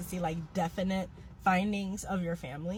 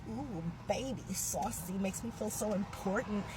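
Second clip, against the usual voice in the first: a soft, lightly voiced sassy purr; playfully pleased with herself, softer; mock flattered; 7 dB quieter than usual. Reacting to dad's Creole story, a softer, mock flattered purr. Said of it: "I was like".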